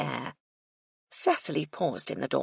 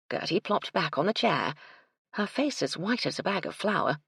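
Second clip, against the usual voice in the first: ordinary delivery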